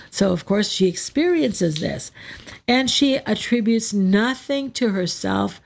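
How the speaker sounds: ordinary talk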